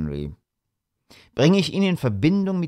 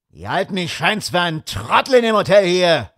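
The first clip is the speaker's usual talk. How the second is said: higher, loud furious cry; angry outburst; 6 semitones higher, 3 dB louder than usual. A narrator's reading. Guest fumes about the hotel, angry shout. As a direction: burst out in anger. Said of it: "rief er".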